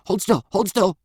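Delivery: high, strained repeated order; muffled-scene command. A narrator's reading. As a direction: bark it high, over and over. Fat man shouting behind the door.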